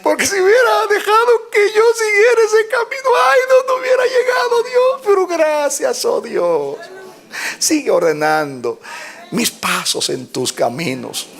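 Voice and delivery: high, loud crying praise; tearful thanksgiving. Acted. Believer at the end crying out thanks to God, fervent. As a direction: cry out your gratitude.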